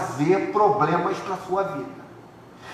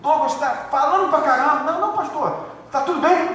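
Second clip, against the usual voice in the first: acted worried pastor; 9 semitones higher than usual.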